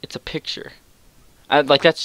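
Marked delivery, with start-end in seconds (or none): 0.0-0.7 whispered, flat and blunt; hushed deadpan retort. Retold or imitated. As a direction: breathe it out like it's obvious. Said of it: "I'm like".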